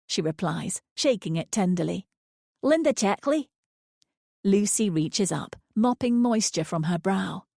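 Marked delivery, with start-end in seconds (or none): 2.6-3.5 put-on higher, sweet West Coast old lady; name offered proudly; old lady's voice. A narrator's reading. Linda giving her full name, sweet.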